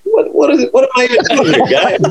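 high, laughing mock outrage; mock exasperation. Caddy retelling his disbelief at the pro, high and laughing. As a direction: play the wronged caddy, amused.

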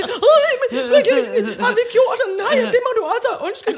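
high, loud, fast panicky apology; teacher voicing the fearful boy; scared boy. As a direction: plead like a frightened kid. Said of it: "Så angst begyndte".

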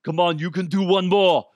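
loud, high gym-coach shout; Arnold coaching. Retold or imitated. Arnold cheering a nervous guy through a final rep, shouting. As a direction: bark encouragement at the lifter. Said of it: "Arnold's like".

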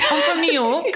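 high, playful fake British accent; hammed-up British accent; put-on British accent. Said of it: "dating with a British accent".

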